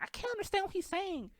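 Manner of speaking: high squeaky imitation of a commenter; mock commenter voice. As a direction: mimic a confused viewer, squeaky. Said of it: "y'all in the comments like".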